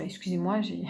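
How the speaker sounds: ordinary delivery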